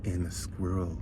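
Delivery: slow it right down and hush for the climax; hushed suspense. A narrator's reading. Teller building suspense before the punchline, slow and hushed.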